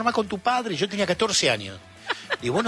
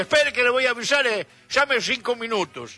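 high, raised-voice phone reply; acted brisk message, pitched up; Galician shopkeeper; 8 semitones higher than usual. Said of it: "el gallego decía".

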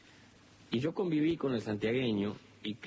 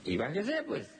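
paisano santiagueño, voz mucho más aguda; 8 semitones higher than usual. Acted. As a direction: saluda cantadito, como viejo de campo. Samaniego le habla. (Samaniego saluda a su visitante con voz aguda de paisano)

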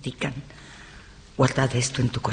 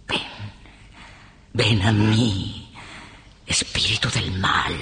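dark invocation; 7 semitones lower than usual. Acted. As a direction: call up evil in a low, half-whispered voice. Summoning evil spirits. (invocación grave y lenta)

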